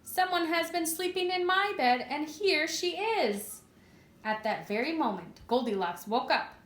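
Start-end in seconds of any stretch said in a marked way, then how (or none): 0.0-3.6 high squeaky cub voice, slowing at the end; Baby Bear's squeak. A narrator's reading. Narrator reading to children as Baby Bear spotting Goldilocks, high squeak. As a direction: squeak it like a tiny cub finding her. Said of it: "He squeaked in his little tiny voice".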